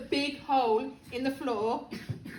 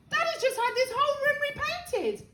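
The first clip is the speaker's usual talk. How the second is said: high, worried objection; anxious protest; 11 semitones higher than usual. A narrator's reading. George worried about Dad's new paint, anxious protest.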